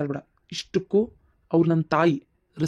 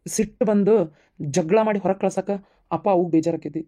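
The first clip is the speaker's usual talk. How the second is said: ordinary talk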